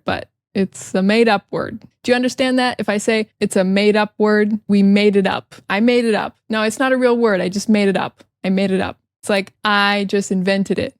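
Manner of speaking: ordinary talk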